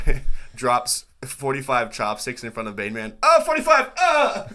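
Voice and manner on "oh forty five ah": very high, loud excited yelp; mock counting outburst. Acting out Bane Man counting chopsticks, high excited shout. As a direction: squeal the number out.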